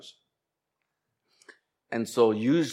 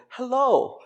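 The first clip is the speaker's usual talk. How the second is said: "Hello." set very high and formal; a prim, high "Hello."; stiff typical hello; 10 semitones higher than usual. Retold or imitated. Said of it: "not, like, the typical".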